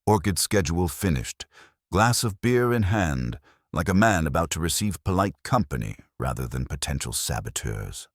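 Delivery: ordinary delivery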